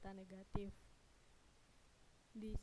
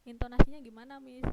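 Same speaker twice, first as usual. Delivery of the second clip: a touch higher and louder, as a pupil asking; lightly voiced student question; 4 semitones higher, 17 dB louder than usual. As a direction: pipe up with a question to the teacher.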